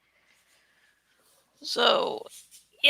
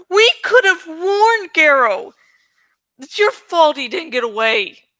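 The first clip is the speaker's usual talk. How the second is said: louder blaming outburst; angry accusing shout; 6 semitones higher, 4 dB louder than usual. Acted. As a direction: lash out in grief. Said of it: "he screamed".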